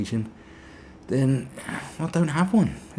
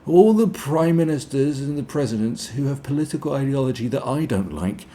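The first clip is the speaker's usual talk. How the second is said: mock conspiracy theorist; 8 semitones higher, 3 dB louder than usual. Mocking a columnist's conspiracy claims. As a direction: rant it like a paranoid crank. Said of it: "a conspiracy lunatic who says".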